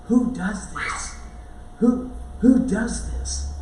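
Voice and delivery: slow, amazed question, drawn out; awed wonder. Retold or imitated. Disciples in the boat after the calm, slow and amazed. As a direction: let each word hang in disbelief.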